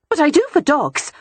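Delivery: breezy and pleased with herself; cheerful. A narrator's reading. Beatrix cheerfully defends her dog biscuits to her brother. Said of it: "Beatrix said cheerfully".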